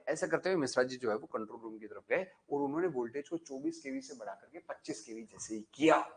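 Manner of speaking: ordinary delivery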